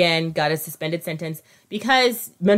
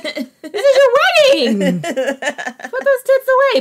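high, slow, laughing scold; mocking the bride's outfit in a high voice; 6 dB louder, slower than usual. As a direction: scold her through laughter. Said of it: "mocking the bride's dress".

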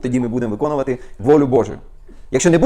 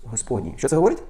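loud, high, fired-up preaching at full force; worked-up preaching; 7 semitones higher, 4 dB louder than usual. Real, not acted. Preacher getting carried away before the congregation, loud and high. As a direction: preach it like you can't hold back. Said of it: "бачите, як я зайшов, завівся, да?".

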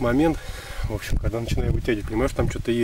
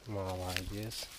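slow, low, gentle praise; tender reward for the dog; soft praise; 3 semitones lower, 14 dB quieter, much slower than usual. Real, not acted. Похвала собаке. (мягкая, тихая похвала)

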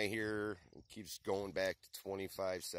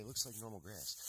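like said in a softer, dropped voice; softer aside; 6 dB quieter than usual. Shown as a demonstration. Repeating the point to viewers, softer. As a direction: murmur it as a reminder.

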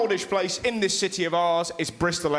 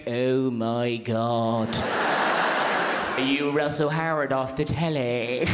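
put-on slow, much lower voice of an excited sixty-year-old woman; 8 semitones lower, slower than usual. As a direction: lower the voice, drawl out the starstruck gasp. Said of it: "honestly went".

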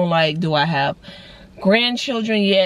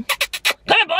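high, sing-song dog call; 9 semitones higher, slower than usual. Shown as a demonstration. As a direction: coax a dog, pitched up. Calling a dog as example.

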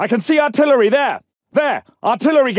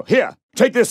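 normal talking voice

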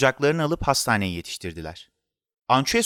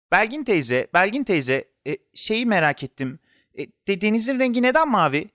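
put-on higher, eager child with little stumbles; curious child; 7 semitones higher than usual. A narrator's reading. Boy asking Belgin teyze about the sea, curious. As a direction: pipe up high with a burning question.

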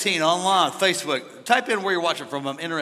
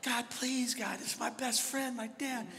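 soft, higher-pitched pleading; retold begging; 5 semitones higher, 8 dB quieter than usual. Son begging God for his dying dad, soft retold plea. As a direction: plead quietly, stumbling over the promises. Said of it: "I remember begging God".

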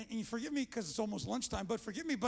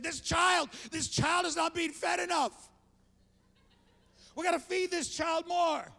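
put-on loud, higher, insisting the baby needs more; insistent shout; 6 semitones higher, 5 dB louder than usual. Grandpa arguing with his daughter about feeding the baby, loud insistence. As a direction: shout it like a worried grandpa who knows best.

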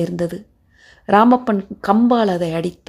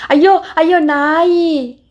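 high, louder, drawn-out wail; startled awake and crying out; frightened cry; 9 semitones higher, 4 dB louder, much slower than usual. A narrator's reading. Erukku is hit awake in fright, high wailing cry. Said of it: "பயந்து போய் எழுந்து".

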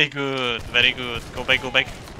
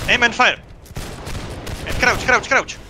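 shouted command; 8 semitones higher than usual. Real, not acted. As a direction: bark the volley command. Shouting line commands in battle.

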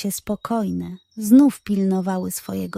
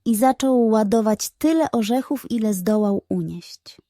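plain reading voice

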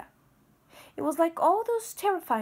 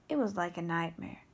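ordinary delivery

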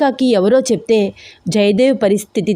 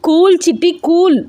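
high, louder call to calm her down; 9 semitones higher, 4 dB louder than usual. Acted. Husband calming panicking wife, loud high call. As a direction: shout her down to settle her. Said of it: "కొంచెం గట్టిగా అరిచి".

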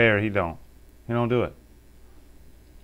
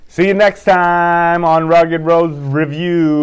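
loud, slow, raised announcer outro; show sign-off; 5 semitones higher, 8 dB louder, slower than usual. Acted. Dad closing his review video, loud host sign-off. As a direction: announce it big, stretching the words.

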